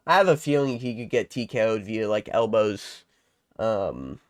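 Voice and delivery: ordinary talk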